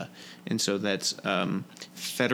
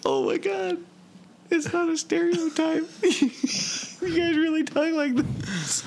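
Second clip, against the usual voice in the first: amazed disbelief. Retold or imitated. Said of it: "I was like".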